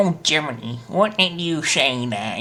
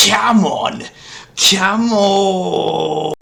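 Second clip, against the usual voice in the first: loud, higher "come on" cry; shouting protest; 5 semitones higher, 7 dB louder, much slower than usual. Real, not acted. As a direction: yell it in disbelief.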